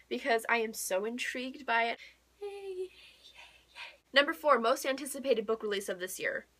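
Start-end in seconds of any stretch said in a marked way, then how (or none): none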